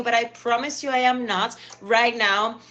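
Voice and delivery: usual speaking voice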